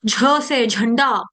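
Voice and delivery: slow झ thrown out in झंडा; drawn-out, breath-pushed झ in झंडा; forced aspirate झ. Shown as a demonstration. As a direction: push a puff of air through झंडा. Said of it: "अक्षर बाहर को फेंकना है".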